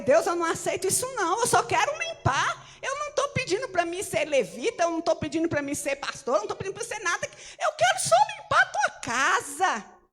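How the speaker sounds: rising to a near-sob plea, slowing at the end; pleading prayer. Retold or imitated. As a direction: beg God to let you just clean. Retold prayer, begging God.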